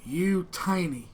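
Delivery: slow, halting broken speech; slow halting Common from a dragonborn boy; super slow broken Common. Acted. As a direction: spell it out slowly for a foreigner. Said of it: "starts speaking in super slow Common".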